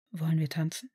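breathy whispered invitation; murmured. A narrator's reading. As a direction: lean in and whisper it to your fiancé. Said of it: "raunte Draco".

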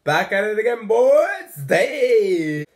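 loud, high hype greeting; excited greeting. Real, not acted. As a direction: pump up the viewers. Vlog greeting to viewers.

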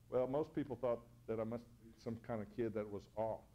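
ordinary delivery

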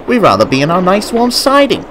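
high, whining coach; mild shriek of protest. A narrator's reading. Coaches longing for their siding, mildly shrieking. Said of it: "shrieked the coaches".

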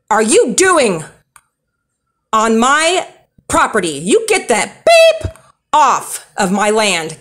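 loud, high yelling at a stranger outside her window; hammered out, furious, ordering him off her yard; retold angry yelling. Woman yelling at a man outside her window, loud and furious. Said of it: "I was cursing at him and cussing".

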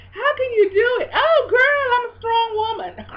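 high crying shout, hammed-up; mock wail. Mocking her own complaint to the church in a high crying wail. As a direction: wail it like a self-pitying woman, played for laughs.

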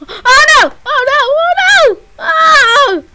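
high, loud crying wail; panicked wail. Real, not acted. Gamer wails as the sword is about to break, loud and high. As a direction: wail as everything goes wrong.

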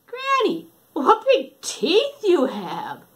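put-on high, slow amazed girl; drawn-out child's wonder; wondering little girl. Red noticing big teeth, slow and amazed.

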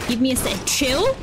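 ordinary talk

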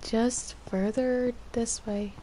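ordinary talk, nothing marked

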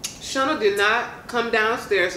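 talking while chewing chicken; mumbling around food; mouth full, chewing. Real, not acted. Seen 1: Both women bite and chew wings throughout; speaker unclear.

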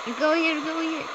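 high, loud urging yell; shouting the push forward; excited shout. Real, not acted. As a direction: yell it to hype them up. Urging someone into danger.

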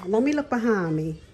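normal talking voice

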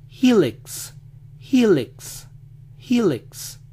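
"helix" as "HEE-lix", stressed first; American "helix", E said as I; high, falling "HEE-leks" for "helix"; English pronunciation. Shown as a demonstration. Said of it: "como si las vocales se hubieran cambiado".

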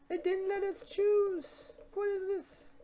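high, slow, incredulous whine at the broken game; a squeaky what-is-this; exasperated squeak. Real, not acted. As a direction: pitch way up in disbelief.

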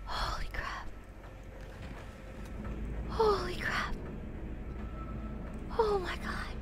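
breathless hushed whisper. Real, not acted. Shaken gamer hiding from enemies, whispering. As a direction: barely voice it, shaken.

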